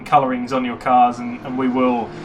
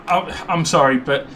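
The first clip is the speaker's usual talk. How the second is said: normal talking voice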